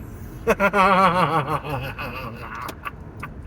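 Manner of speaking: long rolling mock-evil laugh; maniacal villain laugh. Driver playing harmless villain after cutting off friends, maniacal laugh. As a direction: cackle on and on like a cartoon villain. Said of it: "maniacal villain laughing".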